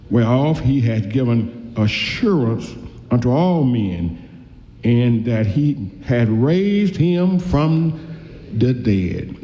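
slow, solemn reading of the verse; preacher reading scripture aloud, slow and solemn. Acted. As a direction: read the scripture slowly and deliberately.

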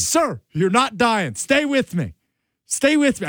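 high-pitched, insistent reassurance; retold emergency plea, pitched right up; urgent pleading. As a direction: plead with a fading man to hang on. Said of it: "the way you're saying it right now".

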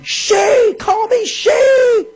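high, drawn-out mimicking wail; mock yelling. Radio host imitating a person's meltdown for listeners, mock yelling. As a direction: yell it high, mocking someone's meltdown. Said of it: "this product of the American left starts yelling".

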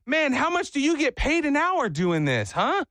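high, eager impression of a nosy relative; nosy enthusiasm. Father-in-law prying into a worker's pay, high eager voice.

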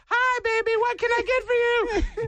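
very high falsetto lady impression; Miss Glenda. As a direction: put on a high-pitched counter-lady voice. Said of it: "I acted like Miss Glenda".

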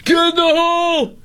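high-pitched, hammed-up gallery holler; mock fan yell. Show-off fan announcing his yell, high mock holler. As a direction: belt it out high like a show-off golf spectator. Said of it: "anybody that yells".

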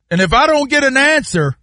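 normal talking voice

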